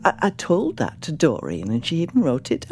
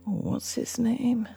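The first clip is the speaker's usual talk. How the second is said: whispering; 8 semitones higher, 5 dB quieter than usual. Acted. Old mother gossiping about visitor.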